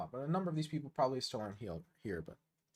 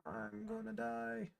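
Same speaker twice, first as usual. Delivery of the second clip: higher, softer and slow, like a fading last breath; mock dying wail; 8 semitones higher, 10 dB quieter, much slower than usual. Streamer hams up his doom to chat, slow high and soft. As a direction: ham up a tragic death moan. Repeats the line as a dramatic take.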